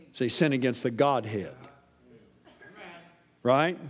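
ordinary delivery

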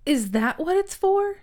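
hammed-up disbelief at a friend; mock incredulous question. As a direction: mimic a dumbfounded question. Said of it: "you said it like that".

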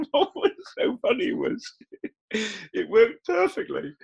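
laughing. Real, not acted. Laughing at own goat story.

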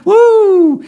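a loud, high victory whoop; joyful whoop. Real, not acted. Preacher celebrating the money coming back, loud high whoop. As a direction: let out a triumphant woo.

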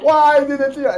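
high, wailing mock despair; mock anguish. Teacher retelling the moment a student cried, mock despair. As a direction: cry out in exaggerated dismay. Retold dismay at student's tear.

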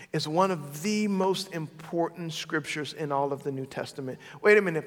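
usual speaking voice

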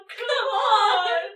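high crying shout; whiny shout. Retold or imitated. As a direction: yell it like a scared whiner. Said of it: "Just from the".

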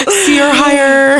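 laughing through the words; giggling it out. Real, not acted. Laughing banter.

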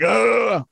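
high mock "ugh" of a cutting-out connection; imitated glitch groan. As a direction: groan like a dropped call. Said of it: "it went like".

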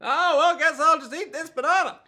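very high, hammed-up old man. Aged Narantia settles for a banana, old man voice. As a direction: pipe up like a dotty old man. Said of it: "like an old man would".

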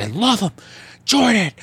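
high mock yell of devotion; ranting fan. Fan pounding his chest over Jordan, hammed-up yelling.

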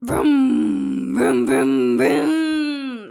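loud revving vroom noises; motorcycle revving sound. Pretend. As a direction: rev it like a motorbike engine. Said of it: "That's my motorcycle impression".

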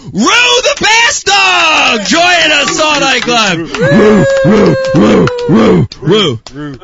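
high, loud, slow announcer shout; hammed-up arena announcement; hype shout. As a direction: call her name out like a ring announcer. Shouting the dog's big introduction.

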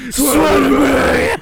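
hammed-up roar. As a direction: bellow it like a frantic headmaster. Dumbledore demanding an oath.

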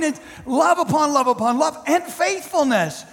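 normal talking voice

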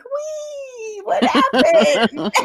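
high laughing squeal slowing down; mock-dazed, high and drawn out; dazed, giddy heroine. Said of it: "She's like".

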